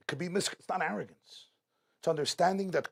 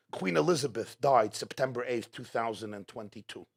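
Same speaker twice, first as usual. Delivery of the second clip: ordinary delivery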